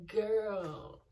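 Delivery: put-on giggly amused "oh, girl"; a squealing laugh, then a knowing "girl". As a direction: laugh through it, mocking and amused. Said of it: "she's just like".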